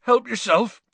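high, choked-up gruff giant; tearful. Acted. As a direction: offer it through the last tears. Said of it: "wiping his eyes".

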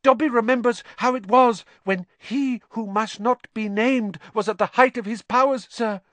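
high-pitched, loud little house-elf voice; squeaky elf recounting old miseries; Dobby. A narrator's reading. House-elf confiding in Harry at his bedside, high and earnest. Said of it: "he admitted".

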